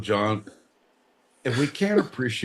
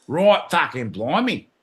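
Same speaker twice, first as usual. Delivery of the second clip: louder, higher mock Australian; Australian accent; 4 semitones higher, 3 dB louder than usual. Joking for Australian listeners, put-on Australian accent. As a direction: put on a broad Australian that slips into Cockney. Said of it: "that's all Australian for you".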